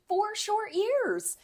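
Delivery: slow, high and drawn out. Acted. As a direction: stretch it out slowly. Mock dramatic sigh.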